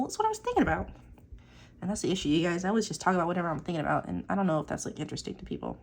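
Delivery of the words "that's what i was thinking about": defensive squeak. Retold or imitated. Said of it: "I'm like".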